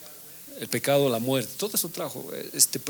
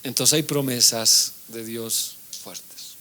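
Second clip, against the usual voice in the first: normal talking voice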